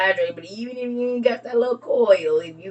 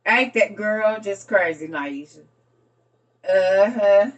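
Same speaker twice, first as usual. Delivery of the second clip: ordinary talk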